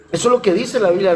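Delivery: normal talking voice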